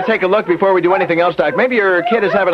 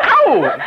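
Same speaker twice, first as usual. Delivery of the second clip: pained yelp; 13 semitones higher than usual. Acted. Burns fingers on match.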